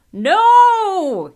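high, loud, drawn-out cry of defeat; shouting. A narrator's reading. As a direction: bellow a desperate refusal. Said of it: "he shouted".

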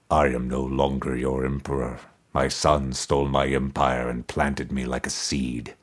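low, soft, toneless voice of a buried skull; resonant toneless skull. A narrator's reading. Buried emperor's skull answering Mordecai, low resonant toneless voice. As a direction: intone it without any tune, deep in the earth. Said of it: "answered in a resonant voice, toneless".

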